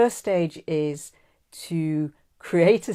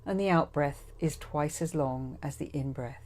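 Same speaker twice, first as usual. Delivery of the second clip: guided meditation; 8 dB quieter than usual. Acted. Guide settling listeners to breathe before forgiveness, guided meditation voice. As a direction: ease them gently into calm, trailing softer.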